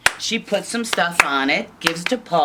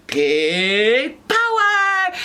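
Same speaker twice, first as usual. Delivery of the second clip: very slow, louder, higher cheer; cheering pigs; 8 semitones higher, 5 dB louder, much slower than usual. Acted. Pigs cheering their win, slow loud chant. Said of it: "they all went".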